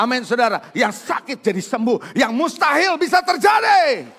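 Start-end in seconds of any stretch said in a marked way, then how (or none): 2.1-4.1 high, loud, slow proclamation; preaching shout. Acted. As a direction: cry it out to the congregation. (seruan khotbah yang keras dan tinggi)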